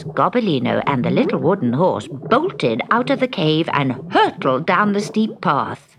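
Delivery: ordinary delivery, nothing marked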